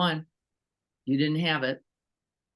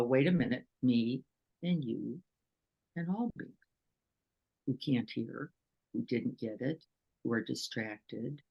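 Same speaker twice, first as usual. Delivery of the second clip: softened reflection; 4 semitones lower, 6 dB quieter than usual. Retold or imitated. Pausing to recall all beings.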